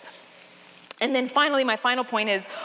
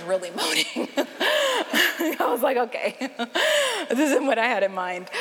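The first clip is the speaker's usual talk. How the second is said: laughing through the words; 2 semitones higher than usual. Real, not acted. Laughing while telling her own story.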